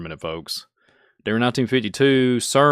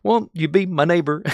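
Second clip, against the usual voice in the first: higher, gentle TV host impression; old TV host; 7 semitones higher than usual. Mocking an old TV show host, mild impression. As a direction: lightly mimic a seventies children's show host. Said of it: "the T V show".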